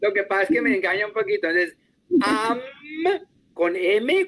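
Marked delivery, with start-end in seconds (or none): none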